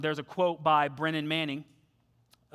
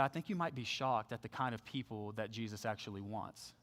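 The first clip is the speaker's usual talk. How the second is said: soft, sincere; 8 semitones lower, 12 dB quieter than usual. Retold or imitated. Sharing hope, softly.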